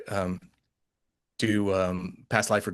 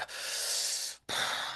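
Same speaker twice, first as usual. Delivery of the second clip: heavy sigh; 11 dB quieter than usual. Retold or imitated. Dreading walk past cemetery.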